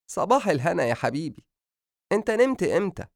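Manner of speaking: high-pitched motherly good morning; mother greeting son. A narrator's reading. Mother greets her waking son.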